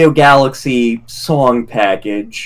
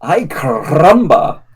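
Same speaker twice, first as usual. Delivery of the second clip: put-on Spanish accent. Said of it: "my Spanish ac-, accent is horribly rusty".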